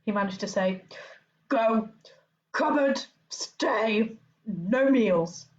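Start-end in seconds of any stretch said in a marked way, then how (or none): 1.5-5.5 choked with rage, barely getting words out; clipped furious commands, a hint of Vernon; strangled voice. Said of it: "so angry he could hardly speak".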